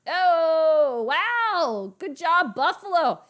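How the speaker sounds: loud, high burst of delight; excited cheer. Real, not acted. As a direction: cheer on your team, loudly. Cheering a live game play.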